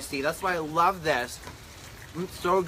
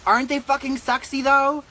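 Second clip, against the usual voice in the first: excited; 9 semitones higher, 5 dB louder than usual. Real, not acted. Gushing over the servers.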